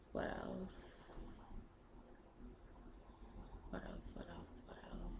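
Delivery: very soft, near-whispered thinking aloud; muttering to self. Real, not acted. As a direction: murmur it to yourself while searching for ideas. Thinking aloud between answers.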